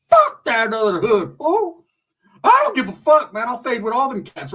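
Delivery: high, loud defiant outburst; retold defiant shout. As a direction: shout it back, spoiling for a fight. Retold defiant answer to homeboy.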